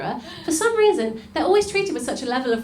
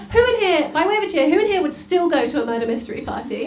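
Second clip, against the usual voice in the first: ordinary talk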